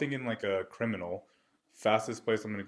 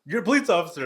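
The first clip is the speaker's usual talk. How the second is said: higher, louder pointed reminder; insistent reminder; 9 semitones higher, 9 dB louder, much faster than usual. Retold or imitated. Brother reminding the officer of his job, loud insistent. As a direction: push it on him.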